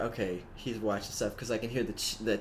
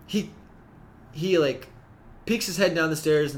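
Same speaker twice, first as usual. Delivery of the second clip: ordinary talk, nothing marked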